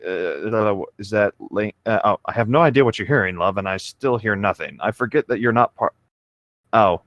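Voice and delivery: ordinary delivery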